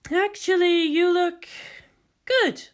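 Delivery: high, light female voice, teasing compliment; Kodama's voice. Acted. Kodama to Makoto in costume, high playful approval.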